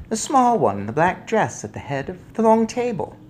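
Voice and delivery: put-on high, composed old lady; sly grandmother. A narrator's reading. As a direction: pick her out precisely. Grandmother identifies the witch.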